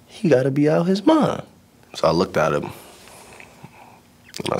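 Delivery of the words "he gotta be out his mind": incredulous inner thought. Retold or imitated.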